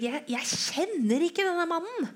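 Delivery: high, loud, desperate denial; swearing denial. Acted. Peter swearing to the servant girl, high and desperate. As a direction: swear you never knew him. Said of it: "ga han seg til å banne og sverge".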